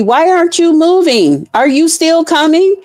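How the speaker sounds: high, mock-impatient texted question; impatient passenger. Passenger texting the driver, high and impatient. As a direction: needle her, where are you. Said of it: "The passenger is contacting me".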